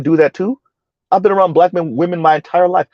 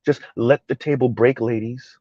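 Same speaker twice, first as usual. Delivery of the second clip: soft, measured, lower imitation of a calm speaker; mock calm tone; 4 semitones lower, 6 dB quieter than usual. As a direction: lower your voice and feign composure. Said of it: "they speak like this".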